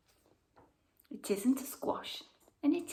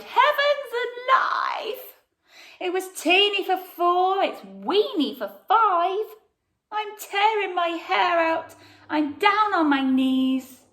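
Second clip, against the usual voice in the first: desperate cry; 7 semitones higher, 6 dB louder than usual. Acted. Old lady wailing to the wise man about the crowded house, high and loud. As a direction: cry out at the end of her tether. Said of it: "The little old lady cried".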